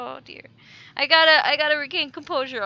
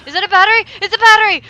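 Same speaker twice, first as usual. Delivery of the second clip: loud, high, thrilled shout; excited discovery; 6 semitones higher, 18 dB louder, much faster than usual. Real, not acted. Player spotting a battery, thrilled shout. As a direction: shriek with joy at the find.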